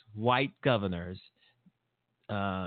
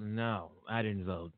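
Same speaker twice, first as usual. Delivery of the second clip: quiet shrug; 4 semitones lower, 7 dB quieter than usual. Retold or imitated. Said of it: "People are out there".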